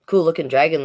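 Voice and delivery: plain reading voice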